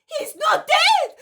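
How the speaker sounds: very high, loud, slow raving cry; frenzied shrieking. Acted. Simon in convulsion shrieking at the crowd, very high raving scream. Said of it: "and shouts, shouts".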